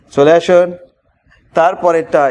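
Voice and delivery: usual speaking voice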